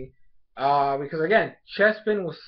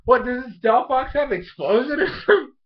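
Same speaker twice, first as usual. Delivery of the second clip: much higher, loud disbelieving cry; baffled outburst; 9 semitones higher, 3 dB louder than usual. Retold or imitated. Vlogger replaying her own reaction to the episode, high baffled outburst. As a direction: throw the question up high in mock confusion. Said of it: "And I'm like".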